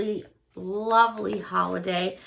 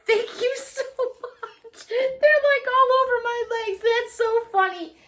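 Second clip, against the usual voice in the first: high, squeaky voice through laughter; cracking up while talking; real laughter; 10 semitones higher than usual. Real, not acted. Thanking a friend for the joke gift, real laughter.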